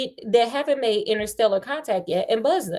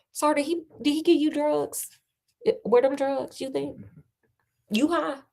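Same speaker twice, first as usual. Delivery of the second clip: higher, softer mock concern; teasing aside; 5 semitones higher, 3 dB quieter than usual. Real, not acted. Reader asking Arthur if he's high. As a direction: tease the character playfully.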